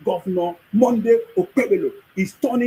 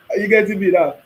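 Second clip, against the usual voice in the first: ordinary talk, nothing marked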